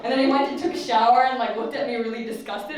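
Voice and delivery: ordinary talk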